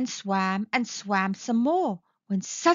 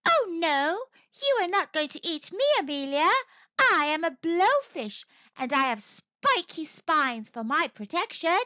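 higher-pitched little blowfish voice; defiant blowfish; 5 semitones higher than usual. Acted. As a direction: play a blowfish refusing to be eaten. Blowfish answers shark.

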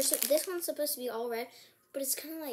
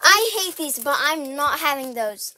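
loud protest; 12 dB louder than usual. Real, not acted. Kids daring each other to eat.

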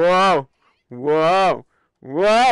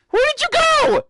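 very high, sustained sing-out call. Pretend. Player searches for a vanished companion, high calling out. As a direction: call out high for someone who has vanished. Looking for lost game creature.

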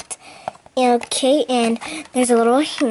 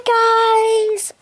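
squeaky bye; 11 semitones higher, 5 dB louder than usual. Pretend. Sign-off greeting in bird voice.

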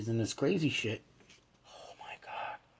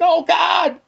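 high, loud, fast panicky babble; mock panic; 13 dB louder than usual. Born-again kid listening to Iron Maiden, fast panicky squeal. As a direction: gabble like a scared kid expecting punishment. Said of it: "I'd be like".

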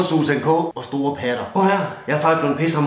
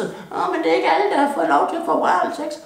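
much higher, mocking a sulky offended woman; high mock whiny complainer; 12 semitones higher than usual. Comic drunk mocks complainers in a higher whiny voice. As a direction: pitch it up and whine like an offended prude.

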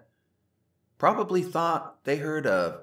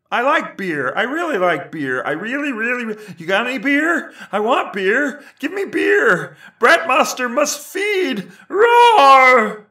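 exploding, roaring, much higher and louder; sudden loud outburst; 11 semitones higher, 11 dB louder than usual. Acted. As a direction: build from drunken craving to a monster roar. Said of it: "when he exploded on the Senate floor about beer".

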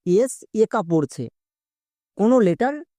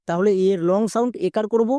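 ordinary talk

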